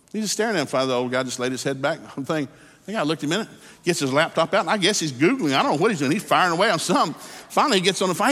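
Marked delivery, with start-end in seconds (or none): none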